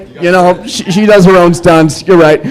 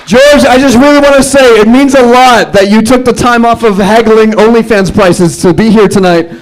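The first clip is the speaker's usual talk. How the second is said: ordinary talk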